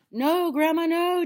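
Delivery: high, loud alarmed shout; calling back. A narrator's reading. Boy pleading grandma to stop, calling back. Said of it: "George called back".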